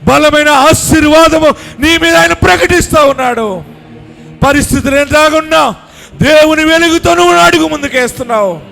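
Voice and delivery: loud, high, driving sermon climax; fervent revival preaching, voice lifted high; full-force preaching. Acted. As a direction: preach it to the back of the tent. Preaching climax over music.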